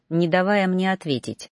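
plain reading voice

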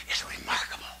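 awed whisper. Real, not acted. Awed hush.

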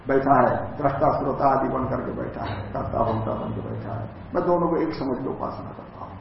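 ordinary talk

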